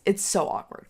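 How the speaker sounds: ordinary talk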